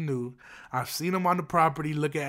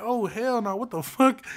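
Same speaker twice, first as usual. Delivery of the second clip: higher-pitched retold outrage; indignant disbelief; 6 semitones higher than usual. Storyteller reliving spotting the food thief, indignant disbelief. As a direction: act out a startled "no way". Reacting to seeing the theft.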